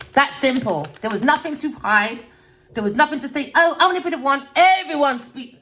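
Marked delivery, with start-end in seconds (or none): 3.6-4.5 higher, louder mocking voice of a show-off; mock wannabe voice. Mocking people who want to be the one, in a higher, louder put-on voice. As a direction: send up someone desperate to be the one. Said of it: "a lot of people speak like this".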